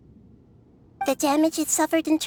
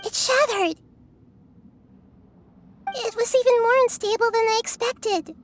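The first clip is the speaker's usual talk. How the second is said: sudden high-pitched alarm; alarmed surprise; 7 semitones higher than usual. Acted. Nahida startled as the Fire Seed shatters, alarmed. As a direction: caught off guard as the seed breaks.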